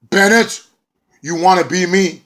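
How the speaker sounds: high, loud challenge; calling out. Retold or imitated. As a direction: call him out to the ring. EC3 calling Bennett out.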